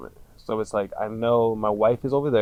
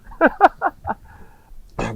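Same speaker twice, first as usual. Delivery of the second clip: high, loud falling laugh; long laugh; 9 dB louder than usual. Real, not acted. Laughing with the host at the irony, high and loud. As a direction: let the laugh roll out.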